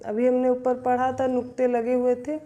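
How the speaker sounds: normal talking voice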